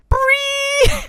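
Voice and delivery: a very high squeal; squeaky photo cry. Acted. Critter shouting for the photo in a squeal. Picture moment.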